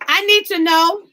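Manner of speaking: ordinary talk, nothing marked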